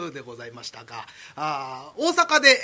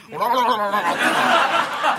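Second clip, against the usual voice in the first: loud, slow acted vomiting noise; mock retching; 3 semitones higher, 6 dB louder, much slower than usual. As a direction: retch it out theatrically.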